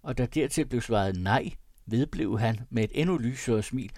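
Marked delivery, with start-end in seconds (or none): none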